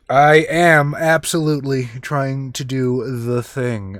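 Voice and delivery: slow, spelled-out declaration; measured, drawn-out confirmation; deliberate emphatic admission. Real, not acted. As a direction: draw it out word by word. Player confirms the manipulation attempt.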